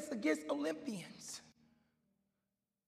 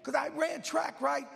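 higher and louder, shouted climax; full-force preaching; 6 semitones higher, 6 dB louder than usual. Acted. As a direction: hammer it home to the church.

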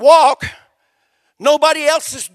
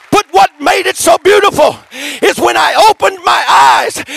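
loud, high climactic telling; full-force preaching; 6 semitones higher, 7 dB louder than usual. Acted. Preacher lifting the congregation with the miracle, shouting. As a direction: build the story to a shout.

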